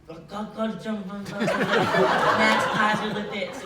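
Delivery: imitated garbled airport announcer; mock announcement ending loud and drawn-out. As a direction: mimic a German tannoy call, drawing out the name loudly. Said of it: "an announcement to the entire airport".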